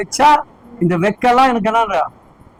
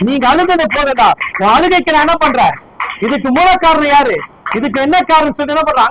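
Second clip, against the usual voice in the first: raised, emphatic sermon voice; full-force preaching; 5 semitones higher, 4 dB louder than usual. Acted. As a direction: drive the words home to the hall.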